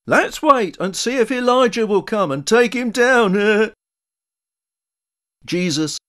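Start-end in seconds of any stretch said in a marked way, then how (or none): 0.1-3.7 loud, high jeer ending in a laugh; jeering onlooker. A narrator's reading. Onlooker taunting the crucified Jesus, loud mocking jeer.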